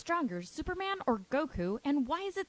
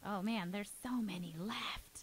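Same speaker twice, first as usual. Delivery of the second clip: whisper; 5 semitones lower, 7 dB quieter, slower than usual. Real, not acted. Groaning at the long question list.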